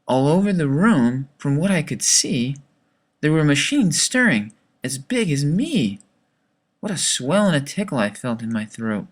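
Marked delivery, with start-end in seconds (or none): none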